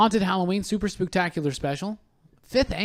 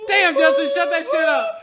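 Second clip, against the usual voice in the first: 高声喊叫让对方关掉音乐; annoyed shout; 12 semitones higher, 7 dB louder than usual. Real, not acted. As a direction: bark it over the blaring song, real irritation. Yelling over the music.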